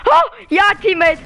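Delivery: excited. Real, not acted. Reacting in a firefight.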